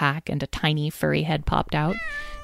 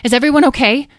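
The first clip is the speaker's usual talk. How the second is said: breathless, louder, higher cry of concern; gasping worry; 9 semitones higher, 9 dB louder, faster than usual. Acted. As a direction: gasp it out as you arrive running. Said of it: "Talora gasped".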